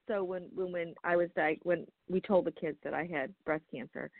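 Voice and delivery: normal talking voice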